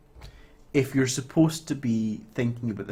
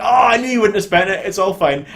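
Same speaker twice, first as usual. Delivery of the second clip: gushing relief; 7 semitones higher, 8 dB louder than usual. Retold or imitated. Relatives reassuring Cher loudly.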